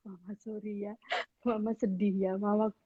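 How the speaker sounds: hushed sweet apology to a sad mother; tender apology. Retold or imitated. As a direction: coax mum softly, almost murmured. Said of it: "Bisa kayak gitu ya".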